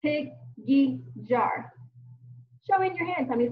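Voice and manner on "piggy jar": slow "Piggy jar" in three beats, pig, gy, jar; "Piggy jar" tapped out syllable by syllable; split into syllables. Shown as a demonstration. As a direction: pause after each syllable of "Piggy jar". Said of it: "Again, watch me".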